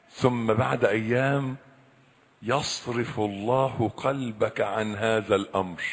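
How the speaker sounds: normal talking voice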